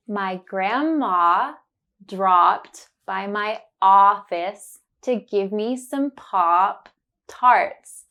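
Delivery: slow careful sentence, leaning on the ah words; slow, focused on the sound. Shown as a demonstration. Teacher slowly modelling the sentence for learners. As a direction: say it slowly, stressing grandma, dropped and Pop Tarts. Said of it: "I will say it slowly and focus on the sound".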